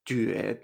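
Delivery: Juede as "jüé-de", rising then short neutral; segundo tono, neutro. Shown as a demonstration. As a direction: Juede with French U and a rising first syllable. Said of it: "Segundo tono y después el tono neutro". (Juede con Ü, tono ascendente y neutro)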